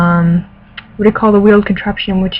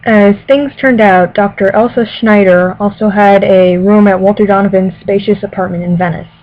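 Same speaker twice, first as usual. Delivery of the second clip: louder, careful novel reading voice; story narration; 5 dB louder than usual. Acted. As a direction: read it like an audiobook. Reads novel passage aloud.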